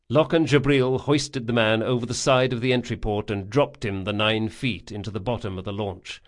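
plain reading voice

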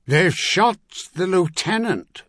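slow, high bellow with weight on shot. A narrator's reading. Priest bellowing the news to the troopers, slow high bellow. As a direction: roar the news out slowly. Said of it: "Charles bellowed".

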